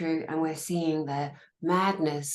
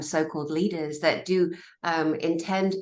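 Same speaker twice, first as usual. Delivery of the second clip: ordinary talk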